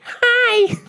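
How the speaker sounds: put-on girly voice. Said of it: "are you gonna call and be like".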